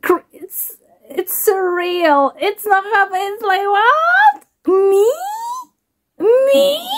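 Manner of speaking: high, breaking voice through happy tears; overwhelmed, voice cracking as tears come; crying with joy. Real, not acted. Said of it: "I said I'm not gonna cry".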